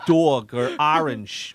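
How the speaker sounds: slow, drawn-out Northeast accent; mock Northeastern vowels on "dog" and "orange". Shown as a demonstration. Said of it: "that accent is not sexy".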